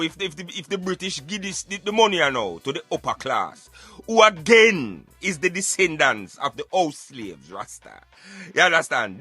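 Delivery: normal talking voice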